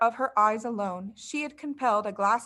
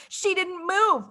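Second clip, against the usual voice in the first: cried out; 8 semitones higher, 4 dB louder, slower than usual. Acted. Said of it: "they cried out".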